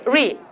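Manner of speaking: 리 with the tongue touching nothing; English r. Shown as a demonstration. Teacher showing trainees English, untouched r in 리. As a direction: glide into 리, never tap. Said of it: "영어는 다면 절대 안 돼".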